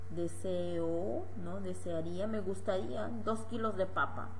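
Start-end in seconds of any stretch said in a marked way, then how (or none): none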